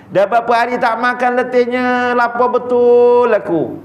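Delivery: pleading beggar. Retold or imitated.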